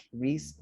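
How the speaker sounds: risk said as "reesk"; the nonexistent word "reesk" for risk; long ee vowel. Shown as a demonstration. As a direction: give risk a tense, long ee. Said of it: "Risk doesn't exist".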